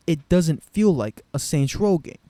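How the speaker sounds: loud exasperated repeat. Real, not acted. Fed-up reviewer shouting his point at critics, loud. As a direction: really make them hear it this time.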